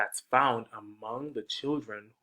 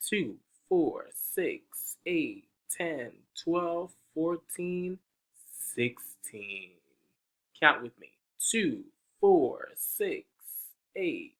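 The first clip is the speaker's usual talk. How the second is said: higher sing-song counting; counting to children; 6 semitones higher than usual. Acted. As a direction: invite the kids to count along.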